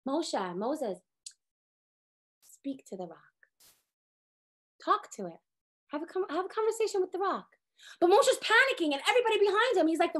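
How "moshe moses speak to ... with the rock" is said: soft divine whisper. Retold or imitated. God softly telling Moshe to speak to the rock. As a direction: hush it gently to Moshe. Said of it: "Hashem says".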